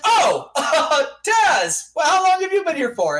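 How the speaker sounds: high, flustered fake surprise; startled, hurried backpedal; caught off guard. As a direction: caught badmouthing someone, cover it quickly. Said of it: "Matt Hardy turns around. He's like".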